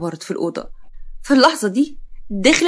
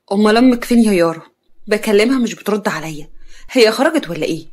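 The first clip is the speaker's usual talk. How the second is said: ordinary delivery, nothing marked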